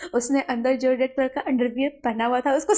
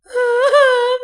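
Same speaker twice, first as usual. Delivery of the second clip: high, loud crying burst; loud wail; 13 semitones higher, 11 dB louder than usual. Acted. Servant bursting into tears before his boss, loud wail.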